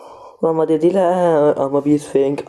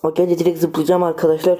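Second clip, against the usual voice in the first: ordinary talk, nothing marked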